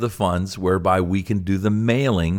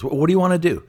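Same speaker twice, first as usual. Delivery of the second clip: retold pastor prodding; 10 semitones higher, much faster than usual. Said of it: "And he goes".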